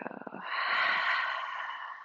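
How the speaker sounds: long open-mouth sigh; cleansing exhale. Shown as a demonstration. Teacher showing how to release stale air, a long sigh. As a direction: let all the air out in one long, voiceless breath. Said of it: "let it go".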